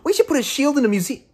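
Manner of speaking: high, quick mocking imitation of a game heroine; mock-chirpy character voice; Kamala Khan. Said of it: "Kamala Khan talks out loud".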